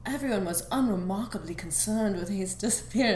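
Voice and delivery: low, soft, bad British accent; badly faked British accent, pitched low; terrible British accent. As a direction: attempt a hushed British teacher and miss. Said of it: "That's a terrible British accent".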